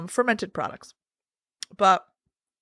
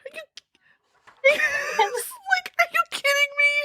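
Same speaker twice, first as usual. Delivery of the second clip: high, laughing are you kidding me; cracking up in disbelief. Real, not acted.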